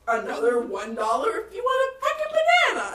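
laughing read. Retold or imitated. Reading the drive-through sign.

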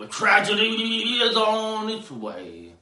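high, loud, drawn-out mock tragedy; theatrical proclamation. Host warning of coming tragedy, theatrical and loud. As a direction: proclaim doom like an old Shakespearean, slowly. Mock Shakespearean foreboding announcement.

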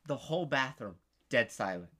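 usual speaking voice

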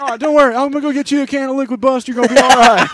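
high, loud hammed-up comfort; mock commercial girl reassuring. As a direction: soothe him, loud and high. Girl voiced offering Liquid Bust.

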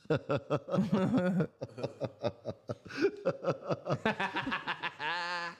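evil chuckle, long and low; a sly villain's cackle; villain laugh. Real, not acted. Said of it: "His villain laugh".

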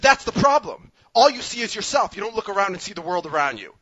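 high, loud outburst easing down at the end; retold righteous telling-off, opening high and loud; indignant scolding. Rider confronting a passive husband on the subway, indignant scolding.

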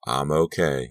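ordinary delivery, nothing marked

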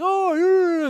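sleepy yawn of a word. Retold or imitated. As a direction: yawn it out, just woken. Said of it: "you're yawning like".

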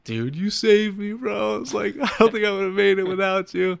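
high, strained crying voice; imitating himself weeping with gratitude; retold tearful thanks. To the runner who shared water, high tearful thanks. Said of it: "I was, like, crying".

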